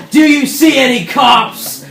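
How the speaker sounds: high, slow, loud pirate retort; hammed-up defiant bellow; pirate's defiant shout.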